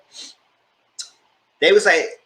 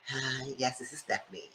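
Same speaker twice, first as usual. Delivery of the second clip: hushed voice; 18 dB quieter than usual. Retold or imitated. Catfish introduces herself, hushed.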